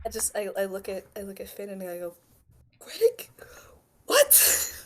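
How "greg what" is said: high, incredulous question, then a breathless what; utter disbelief. Acted. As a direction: act completely baffled by the news. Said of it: "utter disbelief".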